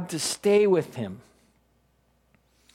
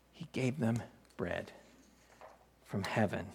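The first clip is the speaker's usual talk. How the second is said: softer repeat; 8 semitones lower, 12 dB quieter than usual. Shown as a demonstration. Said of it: "As it is written".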